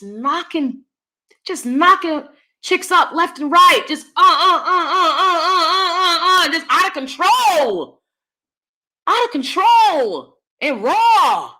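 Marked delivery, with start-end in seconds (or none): 1.5-11.5 heated rant. Real, not acted. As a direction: sputter in disbelief. Ranting, stammering with laughter.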